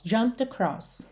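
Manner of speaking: "jumped across" as one syllable, no E, linked into "across"; "jumpt-across", no vowel; silent E. Shown as a demonstration. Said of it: "eu já vou do M direto pro D". ("jumped" sem o E, ligado)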